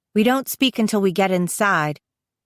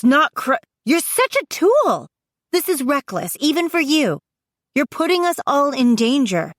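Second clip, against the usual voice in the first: high-pitched exasperated argument; heated scolding; 5 semitones higher than usual. A narrator's reading. Sister warning her reckless brother, heated scolding. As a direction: scold him urgently, pitch riding high.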